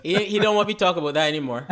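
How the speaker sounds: talking through a laugh; chuckling while telling it. Real, not acted. Laughing about friend leaving.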